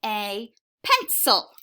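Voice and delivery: put-on slow, word-by-word reading to young children; slow classroom read-aloud. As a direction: read each word carefully for beginners. Teacher reads sight-word book aloud.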